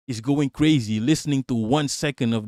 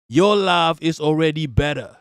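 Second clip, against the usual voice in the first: ordinary talk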